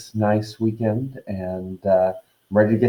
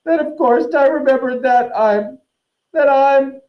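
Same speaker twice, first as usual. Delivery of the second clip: high, loud ghostly howl; 5 dB louder than usual. Acted. Myrtle howling at Hermione about her misery, loud and high. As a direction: wail it to the rafters.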